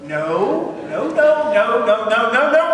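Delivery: hearing about a sister, protesting no; repeated no. Retold or imitated. Refusing another adoption.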